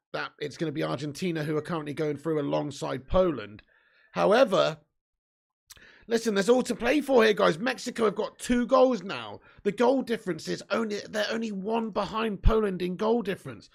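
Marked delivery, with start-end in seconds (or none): none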